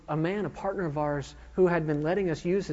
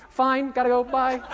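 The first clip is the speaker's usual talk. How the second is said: high, loud, evasive reply; hurried brush-off; 7 semitones higher, 6 dB louder than usual. Acted. Student dodging his instructor after a bad flight, hurried brush-off. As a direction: toss it off and escape.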